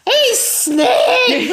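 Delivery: high, loud gleeful yell; excited kids' shout. Retold or imitated. Kids in the car shouting at Alan on the phone. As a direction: shout it like thrilled children.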